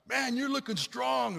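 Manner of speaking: high, loud, slow cheer; encouraging yell. Retold or imitated. Dad cheering struggling runners, loud drawn-out holler. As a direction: holler encouragement, stretching the words.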